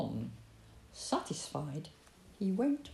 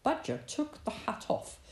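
usual speaking voice

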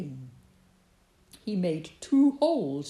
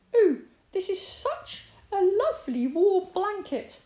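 high little hedgehog voice, a touch sleepy; a small, contented waking hedgehog; 12 semitones higher, 8 dB louder than usual. A narrator's reading. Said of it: "he said sleepily".

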